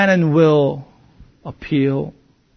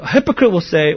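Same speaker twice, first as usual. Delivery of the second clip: ordinary talk, nothing marked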